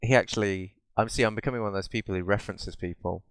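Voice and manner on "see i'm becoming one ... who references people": ordinary talk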